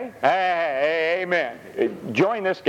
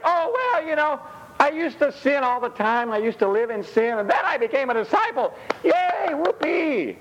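mock worldly convert; 11 semitones higher than usual. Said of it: "It's like".

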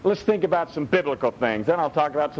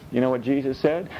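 ordinary delivery

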